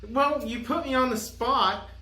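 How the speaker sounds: ordinary delivery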